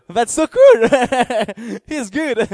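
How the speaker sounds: bubbling over with real joy; delighted laughter. Real, not acted. Preacher delights in God's goodness, laughing and excited.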